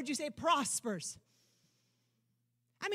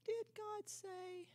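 slow, soft, higher sly taunt; mocker's sly question; 9 semitones higher, 8 dB quieter, much slower than usual. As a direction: draw the doubt out slowly and softly. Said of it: "The voice of the mocker".

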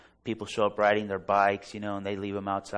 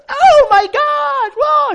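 hammed-up, drawn-out high shriek of fright; scared scream; 20 dB louder, much slower than usual. Startled woman jumping to her car, mock terrified scream. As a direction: scream as if someone is charging at you. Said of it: "She got scared".

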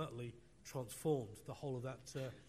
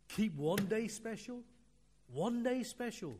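rising preaching emphasis; 7 semitones higher, 7 dB louder, slower than usual. Real, not acted. Preacher decrying loss of Sunday.

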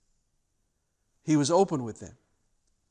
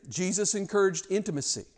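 usual speaking voice

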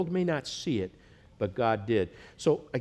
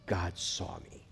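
hushed, slow; 5 semitones lower, 8 dB quieter, much slower than usual. Shown as a demonstration. Translating El Roi slowly.

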